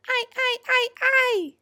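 very high, repeated shriek; creature's scream. A narrator's reading. Glowing eyes dart overhead, shrieking. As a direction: screech each cry high above the normal voice. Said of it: "screamed the eyes".

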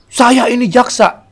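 high, loud, slow boast; high slow pompous boast; pompous prosecutor. Retold or imitated. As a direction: announce your rank grandly. The father boasting his rank.

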